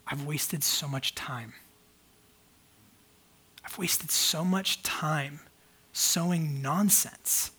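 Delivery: retold low-spirited self-reproach; bummed out. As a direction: say it slowly and heavily, recalling being bummed out. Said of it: "I just got bummed out".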